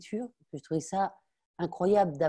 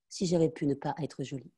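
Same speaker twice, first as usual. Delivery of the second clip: usual speaking voice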